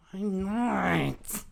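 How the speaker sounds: high put-on boy's protest; high, stretched kiddie complaint; whining kid. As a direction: whine back at mom like a child. Imitating brother protesting to mom.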